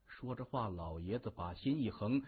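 ordinary delivery, nothing marked

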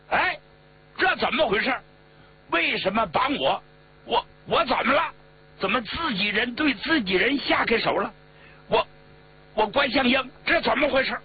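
high-pitched, startled protest; alarmed shouting. A narrator's reading. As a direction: cry out high in shocked bewilderment. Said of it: "高声喊道".

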